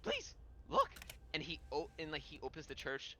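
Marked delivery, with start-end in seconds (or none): 0.0-0.9 very high, urgent squeal; high-pitched hyena man pleading. Acted. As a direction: beg in a high creature voice. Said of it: "the hyena man goes".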